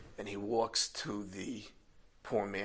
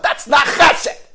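slow, much higher and louder furious shout; fuming; 18 dB louder, slower than usual. Retold or imitated. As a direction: spit out each word in rage. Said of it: "and I was fuming".

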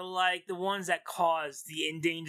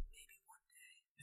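whisper; 34 dB quieter than usual. Pretend. Wendy's worker to vanilla shake.

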